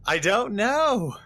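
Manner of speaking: high, drawn-out bafflement; slow, high, stunned answer; bewildered protest. Real, not acted. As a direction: wail that you have no idea. Baffled after exploding in game.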